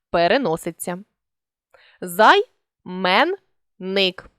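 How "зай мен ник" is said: Зай-мен-ник said one syllable at a time with pauses; teacher dictating syllables; split into syllables. Shown as a demonstration. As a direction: chop Займенник into syllables. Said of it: "При співпадінні двох однакових звуків".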